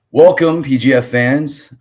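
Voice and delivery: loud, high, drawn-out announcer call; hyped announcer welcome. Acted. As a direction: belt it like a ring announcer. Hyped show intro to fans.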